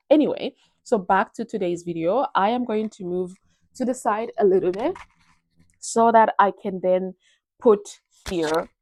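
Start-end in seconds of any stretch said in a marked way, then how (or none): 3.7-8.7 mic bump. Real, not acted. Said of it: "I, I keep hitting the mic".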